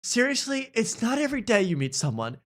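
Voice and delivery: high, earnest gush; mock-heartfelt over the elevator door; Drew gushing. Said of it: "She goes".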